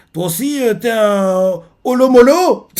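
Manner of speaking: voix haute et traînante; s'étonner devant le géant; étonné. Retold or imitated. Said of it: "il fait". (Luffy étonné, voix aiguë et traînante)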